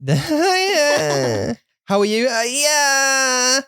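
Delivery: high, drawn-out phoney cheer; fake people. Mocking fake people who greet you, high-pitched hammed-up phoney cheer. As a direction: put on a fake, over-keen greeting. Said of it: "it clicked again with fake people".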